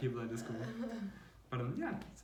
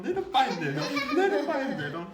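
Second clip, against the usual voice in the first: laughing; 10 semitones higher, 8 dB louder than usual. Real, not acted. Laughing while telling it.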